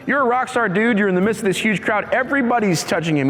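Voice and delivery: high, mocking disbelief; incredulous disciples. Disciples tell Jesus everyone touches him, high incredulous scoff. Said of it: "It's like".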